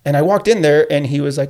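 ordinary delivery

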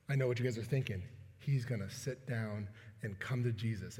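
low, soft aside; hushed aside. Acted. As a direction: drop low and quiet, as if voicing someone's thought. Voicing the audience's hopeful thought.

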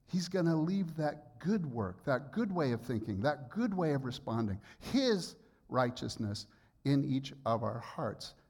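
usual speaking voice

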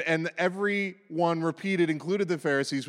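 ordinary talk

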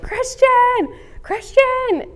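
high, loud desperate calling of a name; frantic mother calling her lost toddler down the street. Retold or imitated. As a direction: shout the name across the block.

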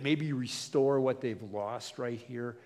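normal talking voice

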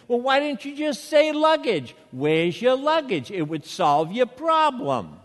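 loud, exasperated retort; wanting to yell. Retold or imitated. Fed-up traveler silently scolds security guy, exasperated yell. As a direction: let out the yell you held back. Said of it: "I wanted to yell at him".

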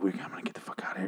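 fast, urgent-sounding whisper. Acted. Robot woman demanding escape, exaggerated whisper. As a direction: rush it out under the breath, no voice. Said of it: "Why are you whispering so much?".

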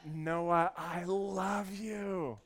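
high, long-stretched holler; proud dad calling across a crowd. Retold or imitated. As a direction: bellow it slowly for the whole pool to hear. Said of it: "I yelled it out louder".

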